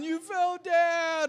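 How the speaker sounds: high, loud mock wail; hammed-up crying, words stretched into a wail; mom crying out. Mom calling out from the stands, mock crying. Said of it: "hear my mom crying".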